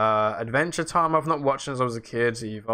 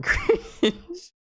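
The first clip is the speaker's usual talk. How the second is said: high, slow laughing cringe. Real, not acted. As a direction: laugh through the word. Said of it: "It's embarrassing".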